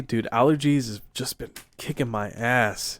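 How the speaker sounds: usual speaking voice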